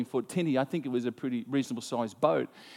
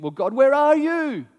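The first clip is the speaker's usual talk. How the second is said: higher, louder, slow pleading call to God; pleading cry; 11 semitones higher, 8 dB louder, slower than usual. Retold or imitated. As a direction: cry out to God in distress, drawn out. Believer crying out in hardship.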